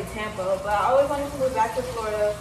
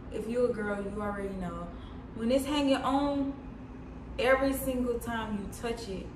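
soft complaining; 3 semitones lower, 6 dB quieter than usual. Real, not acted. Whining about nail.

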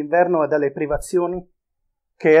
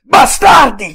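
much louder, higher scream of rage; full furious shout; 9 semitones higher, 10 dB louder, slower than usual. A narrator's reading. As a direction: scream it with everything, breaking open. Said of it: "gridò".

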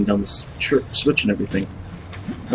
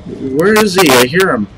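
panicked loud; 5 semitones higher, 7 dB louder than usual. Real, not acted. Hiding from monster, panicking.

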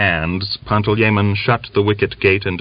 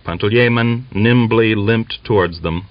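ordinary delivery

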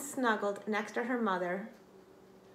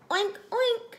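high, louder oinking; pig oink; 13 semitones higher, 6 dB louder than usual. Acted. Pig answers kitten with high oinks. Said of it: "said Pig".

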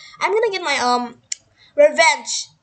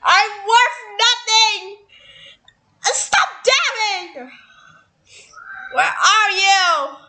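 high, slow, drawn-out yell; melodramatic wail; 5 semitones higher, much slower than usual. Pretend. Player in mock despair hunting someone, melodramatic wail. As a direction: stretch each word into a hammy howl. Melodramatic wailing during the game.

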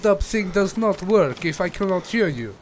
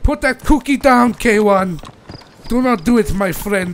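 yelling at the teammate in a fake Russian accent to drop the cookie; shouted order; 8 dB louder than usual. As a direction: bark it at him like a drill sergeant, fake Russian accent. Ordering player to drop item.

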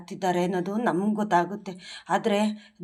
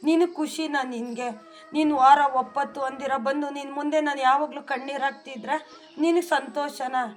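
pleading with god, heartfelt and steady; earnest prayer; 4 semitones higher than usual. Real, not acted. Devotee praying to Rayaru for her family, earnest pleading prayer. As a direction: pour your heart out to the deity in prayer.

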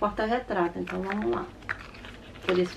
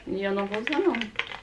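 talking through a sore throat; 3 dB quieter than usual. Real, not acted. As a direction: sore throat, voice a bit strained. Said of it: "a gente não repara a minha voz, porque eu tô com dor de garganta".

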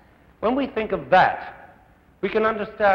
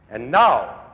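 lower, louder, drawn-out build-up; emphatic announcer's and now; 5 semitones lower, 6 dB louder than usual. Real, not acted. Host introducing the guest conductor, booming announcement. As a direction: announce it like a big entrance.

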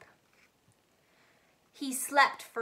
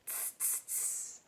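soft unvoiced tss; monkey tsk; 17 dB quieter than usual. A narrator's reading. Monkeys mocking the peddler, soft unvoiced tss. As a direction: hiss it back like a cheeky monkey. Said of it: "the monkeys ... said".

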